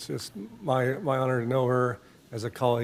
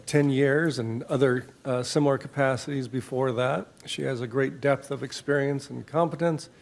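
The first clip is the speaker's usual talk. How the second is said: usual speaking voice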